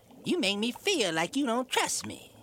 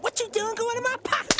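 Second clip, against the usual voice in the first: furious yell. Acted. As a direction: catch her and explode. Husband attacks wife.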